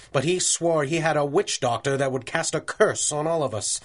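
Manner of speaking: ordinary delivery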